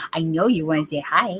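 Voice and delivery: ordinary talk, nothing marked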